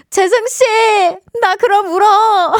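put-on high, slow whiny baby voice; cute whiny aegyo. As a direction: play the sulky girlfriend. Acting cute whiny girlfriend.

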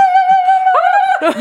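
high sustained chanting cry; ritual chant. Acted. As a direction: call the spirits with one long held note. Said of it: "영혼을 부르는 소리".

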